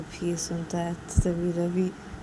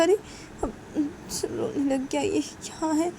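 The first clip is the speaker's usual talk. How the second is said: fretting sister voice; 9 semitones higher than usual. Acted. Woman fretting over crying baby.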